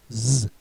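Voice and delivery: "Z" as a buzzed zzz, not the letter name; "Z" sounded out as the bare consonant; English z sound. Shown as a demonstration. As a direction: say "Z" as a held buzzing z. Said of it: "pronounced the same as the Z in English".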